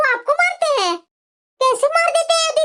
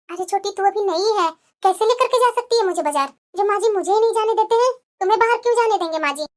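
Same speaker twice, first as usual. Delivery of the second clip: lower, timid voice of a downtrodden wife; meek elder daughter-in-law; 4 semitones lower than usual. Acted. Elder bahu to younger sister-in-law, meek and resigned. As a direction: sigh it out like a bullied bahu.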